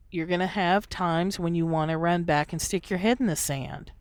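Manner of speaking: normal talking voice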